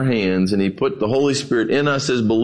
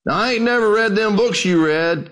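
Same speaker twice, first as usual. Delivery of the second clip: lightly voiced folksy old preacher, higher; a hint of a plain-spoken old preacher; 6 semitones higher than usual. Old preacher needling a scholar between bites, lightly acted. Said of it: "an old dark-skinned preacher".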